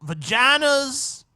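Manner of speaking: high, loud, drawn-out impersonation; hammed-up mock convert, halting and high-pitched; parody testimony voice. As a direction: mimic the testifying man, stretching the words. Parodying the clip's testifying man.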